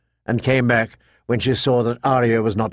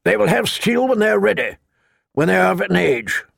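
put-on raised voice of a gruff old master-at-arms; old knight voice; 6 semitones higher than usual. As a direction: command the yard like an old knight.

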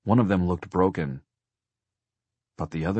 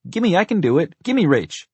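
put-on high, loud child voice; pitched-up little-kid demand; demanding little boy; 13 semitones higher, 9 dB louder than usual. A narrator's reading. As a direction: insist like an eager kid brother. Little brother demands the phone.

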